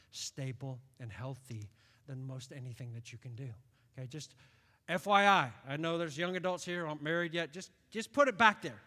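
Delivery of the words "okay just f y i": ordinary delivery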